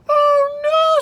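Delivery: high, loud cry of dismay; horrified at what just happened. Acted. Apple falls, Toro dismayed.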